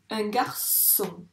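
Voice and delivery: "garçon" slowly split as gar-çon; syllable by syllable. Shown as a demonstration. Teacher counting syllables for a pupil, "garçon" split slowly. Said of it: "les ronds pour chaque syllabe".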